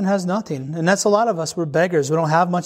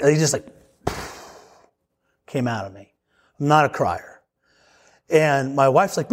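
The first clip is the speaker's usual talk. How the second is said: usual speaking voice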